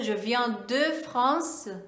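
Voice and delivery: "je viens de France" said slowly; slow model phrase; careful "je viens de France"; slow French example. Shown as a demonstration. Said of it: "eu usaria de".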